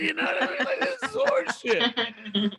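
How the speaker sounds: laughing rant. Real, not acted. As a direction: laugh through the outrage.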